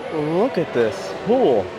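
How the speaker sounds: normal talking voice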